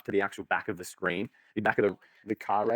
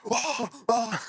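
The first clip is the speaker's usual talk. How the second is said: hyped gasps; 13 semitones higher than usual. Retold or imitated. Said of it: "Everyone's like".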